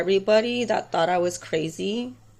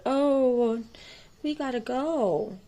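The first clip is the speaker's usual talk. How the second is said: higher, hurried clerk brushing her off; quoted clerk; 6 semitones higher, slower than usual. Retold or imitated. Retelling the clerk rushing off, higher. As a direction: pitch it up as the nervous clerk.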